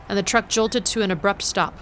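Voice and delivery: plain reading voice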